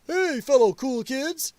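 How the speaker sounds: slow, high, put-on chummy greeting; drawn-out parody of a wannabe cool kid; mock fellow-kid act. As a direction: ham up someone straining to sound cool. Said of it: "that type of crap".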